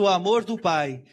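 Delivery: ordinary delivery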